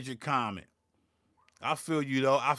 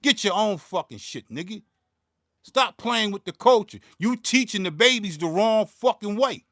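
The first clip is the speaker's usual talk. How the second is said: louder, scolding rant; real anger; 4 semitones higher, 4 dB louder than usual. Real, not acted. Scolding viewers for teaching the babies wrong, louder and angry. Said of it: "I'm mad".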